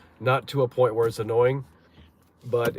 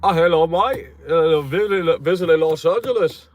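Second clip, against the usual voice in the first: put-on higher, louder caricature British accent; mock British accent; 8 semitones higher, 6 dB louder than usual. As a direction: try to pass as a British visitor, hammed-up. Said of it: "walk in there with that accent".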